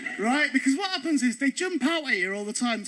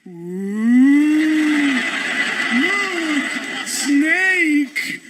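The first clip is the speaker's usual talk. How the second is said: mock fright; 2 semitones higher, 7 dB louder, much slower than usual. Ed mocking the actors.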